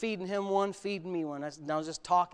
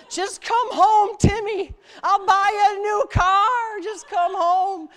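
much higher, louder, slow wailing plea; pleading mother; 12 semitones higher, 10 dB louder, much slower than usual. Retold or imitated. Mother begging her runaway son, high tearful plea. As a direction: beg him home like a crying mom.

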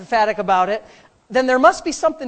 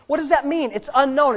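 normal talking voice

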